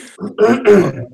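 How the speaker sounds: hard rasping throat clear; harsh throat clearing. Real, not acted. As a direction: clear water from your throat. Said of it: "Water went down the wrong pipe".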